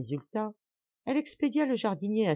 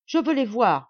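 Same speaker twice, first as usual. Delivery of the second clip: loud, high anguished outcry; distraught cry; 5 semitones higher, 8 dB louder than usual. Acted. Mother crying out in despair to her servants. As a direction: cry out, half mad with grief. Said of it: "s'écria-t-elle avec l'accent du plus affreux égarement".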